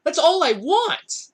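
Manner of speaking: high, slow retold whine of protest; acted exasperated plea. Teller to staring onlookers, drawn-out acted plea.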